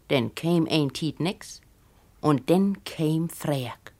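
usual speaking voice

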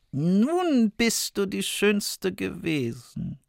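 gloating queen. Acted. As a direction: savour the victory quietly. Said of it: "sprach die Königin".

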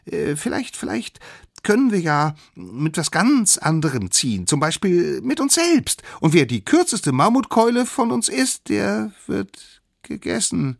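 high character voice, hesitant at first; squeaky voice working out a plan; tentative little proposer. Acted. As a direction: float the idea haltingly, then gain confidence. Character hesitantly proposes a new rule.

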